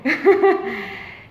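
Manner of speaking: loud bright laugh breaking out; real burst of laughter. Real, not acted. Telling the interviewer about her teacher, laughing out loud. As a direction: laugh freely at the memory.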